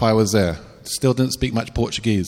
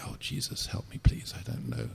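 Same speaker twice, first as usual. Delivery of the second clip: whispered prayer; 2 semitones higher, 14 dB quieter than usual. Retold or imitated. Frightened prayer facing the boy.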